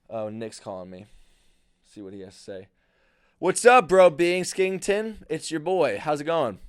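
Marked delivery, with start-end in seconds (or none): 3.4-6.6 loud, high, drawn-out hello; big booming buddy greeting; hearty phone greeting. Real, not acted. Answering a call from his friend, loudly hearty.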